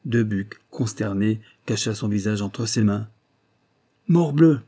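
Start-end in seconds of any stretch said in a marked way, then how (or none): 4.1-4.6 a louder, sharp Morbleu!; outraged exclamation, raised voice; exclaimed oath. A narrator's reading. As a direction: burst out with it like an angry prince. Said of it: "s'écria le héros de Rocroi".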